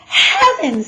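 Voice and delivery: high, loud gasp of a word; a mother's startled exclamation; shocked mother. A narrator's reading. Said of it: "said Missus Lambchop".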